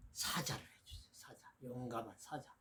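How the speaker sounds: hushed whisper, barely voiced; whispered request. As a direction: breathe it like a secret wish. Customer asks for lion tattoo.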